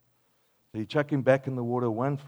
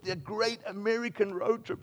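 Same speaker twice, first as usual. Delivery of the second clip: ordinary delivery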